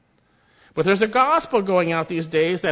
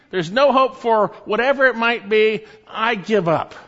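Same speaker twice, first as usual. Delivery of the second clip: slow, despairing voice of someone giving up, growing higher and louder; hopeless complaint; 5 semitones higher, 4 dB louder than usual. Retold or imitated. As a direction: voice a person who has lost all hope, building in despair. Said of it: "you have said".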